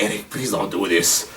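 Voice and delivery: soft, low, begging plea; pleading friend. Retold or imitated. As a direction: beg him quietly to stop. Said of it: "His one friend's like".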